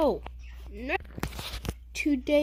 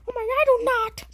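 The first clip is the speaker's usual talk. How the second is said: higher, louder mouse protest; indignant mouse; 8 semitones higher, 7 dB louder, faster than usual. Pretend. Jerry protests to Tweety, higher and louder. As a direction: snap back as Jerry. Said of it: "You kinda do".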